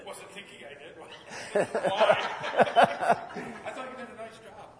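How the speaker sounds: giggling, caught off guard; laughing. Real, not acted. Called on by the pastor, answering while laughing. [name] put on the spot.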